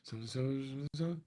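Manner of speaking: very soft repeated so, barely audible; almost whisper the stalling words; talking soft. Retold or imitated. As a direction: producer softly stalling the angry diva. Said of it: "I was just talking soft".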